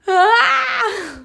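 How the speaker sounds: high-pitched squeal of joy; a real squeal bursting out; excited squeal. Real, not acted. Overcome by visa news mid-lesson, excited squeal.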